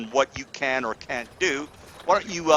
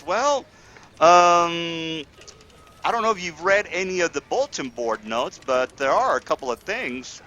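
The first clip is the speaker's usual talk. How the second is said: a hint of a higher lady's voice; lightly voiced wife; 4 semitones higher than usual. Wife pointing the adventurers to the bulletin board, a lightly raised lady's voice. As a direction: nudge them toward the notice board.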